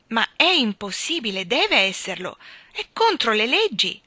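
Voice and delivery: loud, rising insistence; angry protest. A narrator's reading. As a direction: chancellor angrily insisting on the rules. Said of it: "era rossa di collera".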